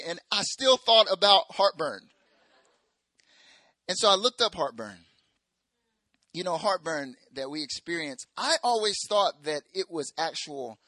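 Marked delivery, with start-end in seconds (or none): none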